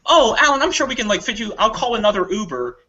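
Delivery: high, fumbling apology; mock apologetic. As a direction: placate him awkwardly. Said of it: "I'm like".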